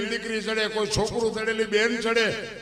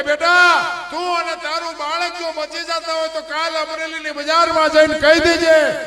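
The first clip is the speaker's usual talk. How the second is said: louder, higher urging; saint's loud command; 8 semitones higher, 9 dB louder than usual. Acted. Saint tells the girl to name him, loud. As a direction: call it out with full conviction. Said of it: "આ ભારતનો સંત એમ કહેતો હોય".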